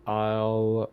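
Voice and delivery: ordinary talk, nothing marked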